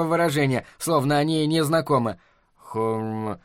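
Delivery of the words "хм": low, soft, squeezed hum; low strained mumble; forced out. A narrator's reading. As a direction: squeeze out a reluctant, muted sound. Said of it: "выдавил толстый Чарли".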